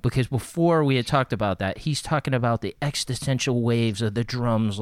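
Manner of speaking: ordinary talk, nothing marked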